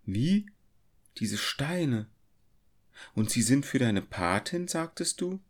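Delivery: stunned by the stones; shocked recognition; astonished cry. A narrator's reading. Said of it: "rief sie".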